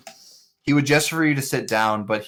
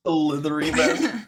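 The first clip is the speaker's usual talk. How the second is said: puzzled echo; 6 semitones higher, 3 dB louder than usual. Acted.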